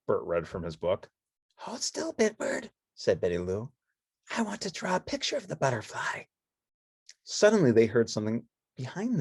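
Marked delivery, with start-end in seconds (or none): 1.6-6.2 put-on high, soft girlish voice; Betty Lou voice. Betty Lou pointing to the bird, soft and high.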